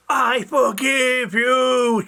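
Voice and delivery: loud, high, slow shout; drawn-out shout to someone who didn't hear. A narrator's reading. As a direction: shout it out, stretching the words. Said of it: "she shouted".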